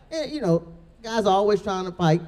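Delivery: usual speaking voice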